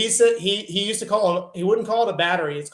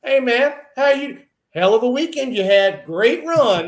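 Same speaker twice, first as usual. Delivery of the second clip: Junior's friendly greeting; 7 semitones higher, 6 dB louder than usual. Retold or imitated. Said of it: "Dustin and goes".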